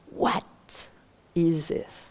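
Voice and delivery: "what" set apart and emphasised, then a pause; weight on the word "what"; stress on WHAT. Shown as a demonstration. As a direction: lean on "what", let "is this" fall away. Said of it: "Should I put some emphasis".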